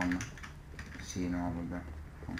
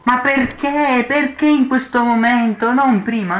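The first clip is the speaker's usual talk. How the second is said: exasperated outburst; 12 semitones higher than usual. Real, not acted. Raging at the lag.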